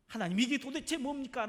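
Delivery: high, loud challenge flung at God; indignant demand. Acted. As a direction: demand an answer, aggrieved. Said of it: "하면서 따질 거예요".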